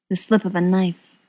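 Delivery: ordinary delivery, nothing marked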